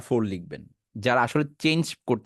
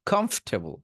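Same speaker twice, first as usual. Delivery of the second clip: Comfortable as one joined word "khomf-te-bol"; parts joined up; 6 semitones higher than usual. Shown as a demonstration. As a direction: run the three parts of comfortable together, high pitched. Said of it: "এবার তিনটা একসাথে বলেন". (Comfortable একসাথে জুড়ে)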